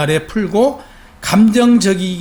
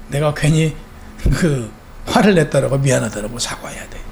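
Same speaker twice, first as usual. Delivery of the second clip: ordinary delivery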